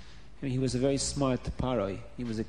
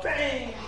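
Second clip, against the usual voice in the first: high-pitched "bang" sound effect; imitated bang; 11 semitones higher than usual. A narrator's reading. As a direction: voice a smash against the wall. Imitating smashes on the wall.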